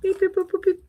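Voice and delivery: high playful beep boop; robot beeps. Pretend. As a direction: chirp it like a little robot.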